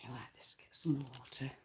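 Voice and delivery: barely audible whispered aside; hushed reminder to yourself. Real, not acted. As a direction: whisper it to yourself away from the mic. Muttered aside off-mic.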